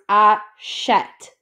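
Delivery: "a chet" said in two clear parts, open e in "chet"; French pronunciation demo. Shown as a demonstration. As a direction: show the French verb form, voice dropping on "chet". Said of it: "bring it up a little bit".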